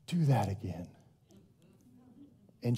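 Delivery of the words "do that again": low, soft coaxing dad voice; encouraging dad. Retold or imitated. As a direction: gently egg the little girl on.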